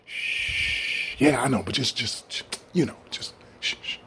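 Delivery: soft mock whisper, hushing the listener. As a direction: shh it down like a secret.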